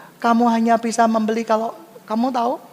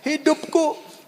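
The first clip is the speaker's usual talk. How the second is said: higher-pitched imitation of complaining; mock discontent; 7 semitones higher, slower than usual. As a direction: act out an ungrateful complainer. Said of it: "selalu merasa". (pura-pura mengeluh tidak puas)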